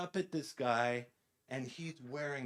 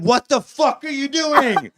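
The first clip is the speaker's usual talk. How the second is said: much higher and louder, a furious shouted question; retold angry shout at a mugger; aggressive yell; 11 semitones higher, 16 dB louder than usual. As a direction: yell it at the attacker you just threw off. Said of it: "I was a little aggressive in my questioning".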